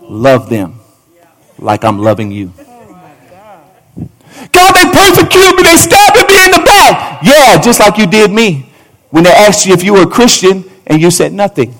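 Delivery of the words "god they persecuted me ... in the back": high, loud wailing complaint; whiny believer's wail. Acted. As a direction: wail it like you're persecuted.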